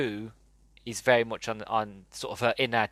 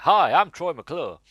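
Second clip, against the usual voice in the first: slower and louder, higher showbiz host voice; Troy McClure impression; 6 semitones higher, 7 dB louder than usual. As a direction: do Phil Hartman's cheesy TV host greeting. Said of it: "He's like".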